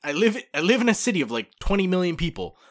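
put-on exasperated grown child setting mom straight; exasperated reassurance. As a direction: plead loudly with a worrying parent. Retold exasperated reply to worried mom.

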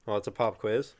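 usual speaking voice

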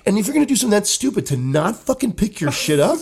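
retold furious tirade at the seat cover culprit; angry rant. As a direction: unload on the guy like you're back in that bathroom. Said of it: "But I wanted to hear, like".

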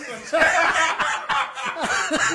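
high, loud belly laugh; burst of laughter. Real, not acted. Guest reacts to a viewer's teasing question, laughing. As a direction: crack up helplessly.

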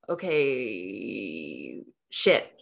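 overwhelmed dismay. Retold or imitated.

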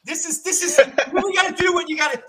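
not calm. Real, not acted. Host answers the yelling guest, excitedly refusing calm. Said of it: "you cannot be calm here".

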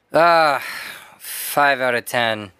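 low, slow imitation of a gloomy guy; deadpan gloom, dragged out; glum customer. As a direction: sigh into a mock-downbeat answer. Voices Mac's glum answer.

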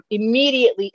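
ordinary talk, nothing marked

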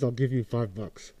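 usual speaking voice

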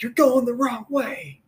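high-pitched mock heckle from passers-by; racers calling out. Racers calling to a runner going backwards, high-pitched mock heckle. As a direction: call out high, imitating onlookers.

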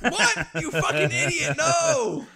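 high, loud mock outburst, shouting at a fool; mocking angry yell. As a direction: yell it like an outraged stranger. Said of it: "and they're like".

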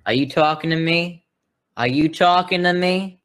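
a light half-hearted De Niro line; lightly voiced mock tough guy; imitating De Niro. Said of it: "the guy who does".

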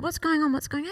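acting out a worried parent checking in; alarmed mother. Mother reacting to son's scream.

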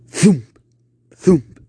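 loud vocal thumps; thumping noise. Acted. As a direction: boom out each thump. Thudding from basement.

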